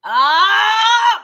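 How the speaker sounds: long, loud, high hammed-up scream; mock scream. Host mocking the actress's scene, long loud fake scream. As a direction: imitate an overacted scream at full volume.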